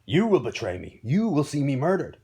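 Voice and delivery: loud, furious accusation; snarled, rising outrage; snarling. Acted. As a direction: hurl the blame at your daughter. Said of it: "he snarled".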